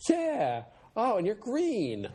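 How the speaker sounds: put-on very high, drawn-out teenager; hammed-up girlish squeal of realization; teen girl. Girl realizing her boyfriend is green, high teen voice. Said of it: "sixteen-year-old girl is like".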